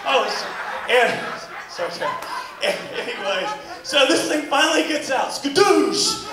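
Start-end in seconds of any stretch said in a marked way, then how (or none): 5.3-6.2 high, hammed-up "Skadoosh"; triumphant cry. Comic celebrating the finish with a shout.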